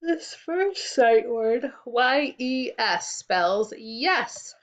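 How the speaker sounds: slow, letter by letter; slow spelling. Shown as a demonstration. Teacher spells a sight word slowly for the class. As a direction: spell it out clearly. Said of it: "two new sight words".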